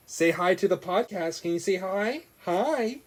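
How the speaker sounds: high coaxing voice. Real, not acted. Dad getting his baby to greet the podcast, high coaxing.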